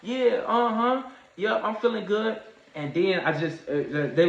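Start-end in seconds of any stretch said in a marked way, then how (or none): 0.0-2.4 high, light fake-fine voice; faking being fine. To paramedics, brushing it off in a high voice. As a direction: pretend to the paramedics you're okay. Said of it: "And I was like".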